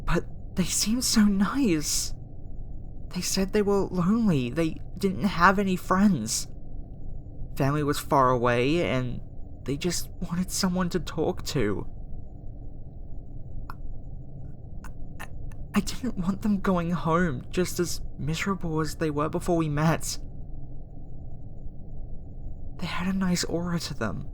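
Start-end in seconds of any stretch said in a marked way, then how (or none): 0.1-11.9 high-pitched, distressed defence of someone; upset pleading. Acted. 15.7-24.3 high, sorrowful confession; regretful and upset, in a higher voice; upset pleading. Acted. Upset regret over sending human home.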